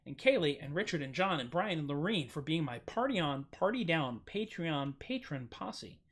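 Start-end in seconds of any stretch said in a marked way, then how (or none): none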